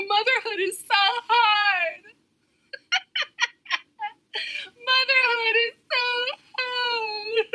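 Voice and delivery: high, whiny fake sob on motherhood is so hard; mock crying. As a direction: ham up a crying mother, laughing through it. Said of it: "crying like".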